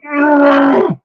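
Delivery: loud high yelp of shock from the audience; crowd's startled yell. Retold or imitated. Retelling the premiere crowd, a loud shocked argh. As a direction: shout it like a crowd recoiling. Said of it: "they go".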